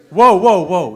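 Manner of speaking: calm the crowd down with a loud whoa; mock alarm. Real, not acted. Reacting to the audience's groan.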